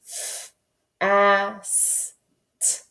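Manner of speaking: "F, a, s, t" said slowly, sound by sound. Shown as a demonstration. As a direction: split "fast" into four separate sounds. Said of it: "Ready, go".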